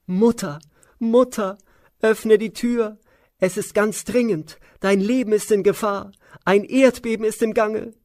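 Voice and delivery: high, loud panicked shriek through a door; shrieking maid. Acted. Mantara at Kaikeyi's door, shrieking high and loud. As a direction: screech the alarm from up high. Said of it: "näherte sie sich der Tür und kreischte".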